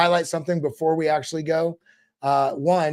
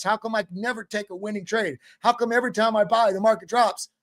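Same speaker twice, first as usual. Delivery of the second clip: usual speaking voice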